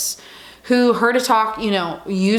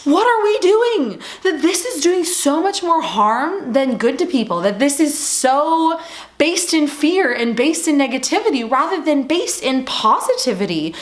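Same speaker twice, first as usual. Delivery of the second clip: speaker's imagined protest, voice jumping high in exasperation; exasperated outcry; 6 semitones higher than usual. Pretend. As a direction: cry out in disbelief at the whole approach. Imagined outcry against the talks.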